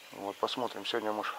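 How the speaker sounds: ordinary talk, nothing marked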